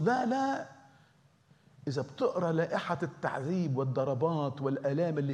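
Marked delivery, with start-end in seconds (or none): 0.0-0.7 emphatic no. Acted. Rejecting Paul's self-abasement.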